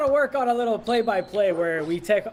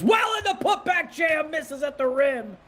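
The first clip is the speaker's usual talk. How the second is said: excited; 7 semitones higher, slower than usual. Real, not acted. Commentator reacting to missed jam.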